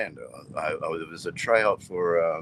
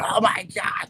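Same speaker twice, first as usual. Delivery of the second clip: mock freaking out; 11 semitones higher, 5 dB louder than usual. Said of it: "like, freaking out".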